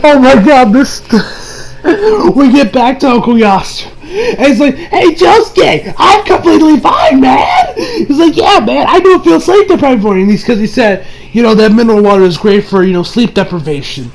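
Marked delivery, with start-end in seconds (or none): 4.8-7.7 high, drawn-out mock exclamation; excited retold exclamation. Acting revived character's exclamation. 8.4-10.2 higher-pitched imitation of a thrilled friend; excited retold reply. Acting revived character's delight.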